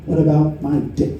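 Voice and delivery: a hint of the Southern caller's drawl. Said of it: "just like the Southern guy did".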